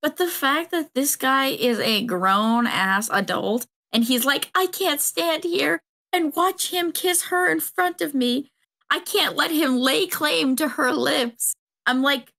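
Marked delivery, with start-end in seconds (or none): 4.5-11.5 much higher, slow, hammed-up melodrama; jealous brother. Mocking the jealous brother's lament, higher and slow melodrama. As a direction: ham up a possessive brother's lament.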